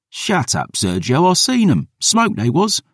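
put-on young lad's squeaky defence; indignant small boy. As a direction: insist like a hurt little boy.

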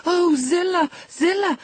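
high, anguished cry; gasped. A narrator's reading. Housekeeper begs the servant for news, gasping in distress. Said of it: "I gasped".

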